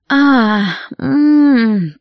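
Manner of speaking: rising pleasured moan; high moan. A narrator's reading. Catherine moaning under the demon's caress. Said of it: "простонала Кэтрин".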